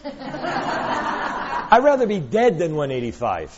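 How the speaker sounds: slow, laughing repeat of the quote; laughing, disbelieving repeat; laughing retelling. Retold or imitated. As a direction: chuckle through the quote again. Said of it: "How's that for a weight loss client".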